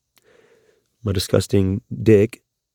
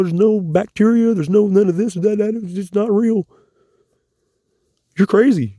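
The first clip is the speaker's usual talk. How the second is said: higher, lightly voiced sneer of people who call him crazy; mocking the dismissive critics; 6 semitones higher than usual. Man voicing critics who say Morgellons isn't real, higher mock scorn. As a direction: parrot the scornful doubters back at them. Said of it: "I can hear people trying to come up with ways to dismiss it".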